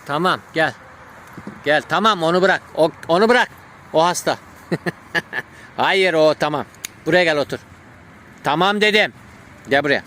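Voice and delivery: loud high commands to a dog; talking to a dog. Real, not acted. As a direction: call the dog back firmly. Calling the dog back, commanding.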